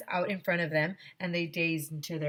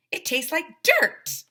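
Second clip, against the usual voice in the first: put-on disgusted kid; 10 semitones higher, 6 dB louder, slower than usual. Kid disgusted by dad's coffee.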